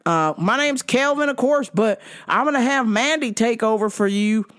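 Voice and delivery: high, drawling waiter impression; put-on server's friendly handover; Kelvin. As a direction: imitate a waiter signing off. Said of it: "Kelvin's like".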